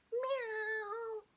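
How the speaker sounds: high meowing cat. Acted. Ginger cat answering Missus Jolly, high mew. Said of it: "my cat voice".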